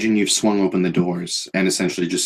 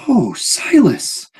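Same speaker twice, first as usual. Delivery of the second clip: much higher, slow, gleeful cry of a name; childlike delight at seeing Silas; like an excited child; 5 dB louder, much slower than usual. Acted. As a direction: greet an old friend like a thrilled kid. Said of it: "sort of like an excited child".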